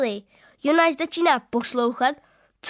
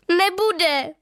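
sobbing; 6 semitones higher, 4 dB louder than usual. A narrator's reading. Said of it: "zliká".

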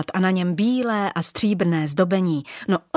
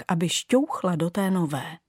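usual speaking voice

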